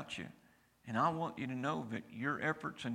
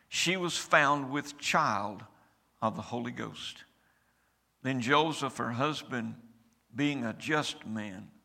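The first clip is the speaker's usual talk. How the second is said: scripture reading; 7 dB louder than usual. Acted. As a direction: proclaim each verse, weighty and measured. Pastor reads Christmas scripture aloud.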